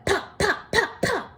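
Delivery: high bright pops; popping sounds. A narrator's reading. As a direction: pop each one like a balloon. Balloons popping sound effect.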